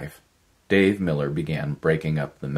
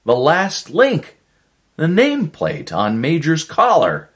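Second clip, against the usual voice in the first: excited shout; 12 semitones higher, 8 dB louder than usual. Acted. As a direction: keep yelling the find. Shouting his discovery on.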